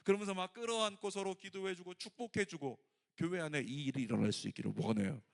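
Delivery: loud, high, fervent preacher's call; preaching at full force. Acted. Pastor urging the congregation to embrace, preached loudly. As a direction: preach it out loud to the whole church.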